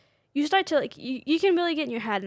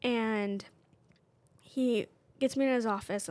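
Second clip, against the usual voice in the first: usual speaking voice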